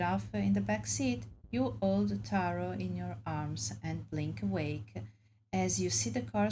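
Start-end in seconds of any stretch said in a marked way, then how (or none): none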